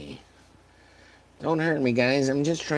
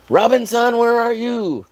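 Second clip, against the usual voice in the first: calling out; 12 semitones higher, 9 dB louder than usual. Real, not acted.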